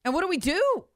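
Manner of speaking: high-pitched, imitating a worried question; retold near-panic, voice lifted high; mild mock panic. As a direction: ask it as if at a loss, pitch jumping up. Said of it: "in kind of almost a panic".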